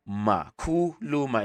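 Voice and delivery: "Kuluma" slowly, ku-lu-ma, falling at the end; actual accent. Shown as a demonstration. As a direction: sound out "Kuluma" with long syllables. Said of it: "this is how you do the actual accent".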